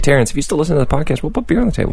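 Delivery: fast, high teasing sing-song; high fast coaxing; high playful call. Pretend. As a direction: pitch it up and coax him, fast. Playful call to absent friend.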